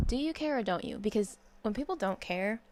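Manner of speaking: ordinary delivery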